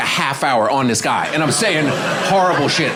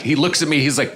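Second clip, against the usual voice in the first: usual speaking voice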